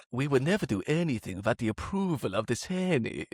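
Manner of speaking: mock Trade Federation film accent, swooping pitch. Podcaster daring listeners to unfollow, hammed-up Trade Federation accent. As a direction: put on the sing-song Trade Federation alien accent. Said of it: "do the Trade Federation accent".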